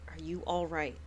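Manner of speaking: low, soft worried question; low concerned noble. Acted. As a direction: ask gently from deep down. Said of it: "Solon was like".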